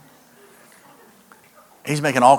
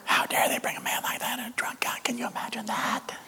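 hushed, higher mock gossip whisper; scandalized whispering; 8 semitones higher, 9 dB quieter than usual. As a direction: whisper like outraged churchgoers behind the preacher's back. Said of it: "And people are whispering".

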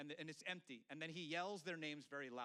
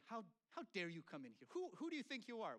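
mock indignation; 6 semitones higher than usual. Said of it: "the contestant gets very mad".

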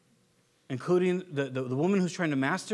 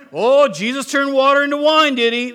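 much louder, higher sarcastic jab; sarcastic wife; 6 semitones higher, 12 dB louder than usual. Retold or imitated. Wife mocking husband's prophecy, loud sarcasm. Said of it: "you'd be like".

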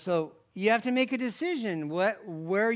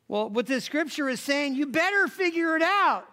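fervent preaching; 6 semitones higher, 3 dB louder than usual. Real, not acted. Preaching at force.

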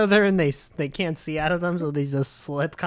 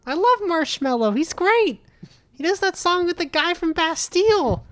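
much higher, excited airhead voice; clueless fan voice; 12 semitones higher, 3 dB louder than usual. Pretend. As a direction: gush like a clueless young fan. Playing clueless fan, high voice.

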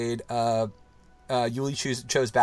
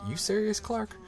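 high, soft Cousin Eddie drawl; lightly voiced Cousin Eddie; Cousin Eddie impression; 9 semitones higher, 3 dB quieter, slower than usual. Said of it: "we'll be like".

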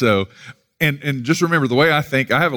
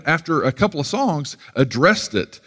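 ordinary delivery

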